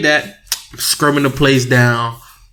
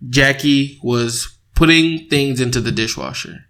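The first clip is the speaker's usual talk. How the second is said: usual speaking voice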